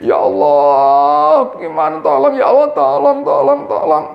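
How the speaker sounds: high, pleading tolong tolong, a slow drawn-out Ya Allah first; desperate begging prayer. Shown as a demonstration. Preacher mocks the wrong way to pray, anxious begging. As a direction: beg God anxiously. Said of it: "doa itu jangan selalu begini".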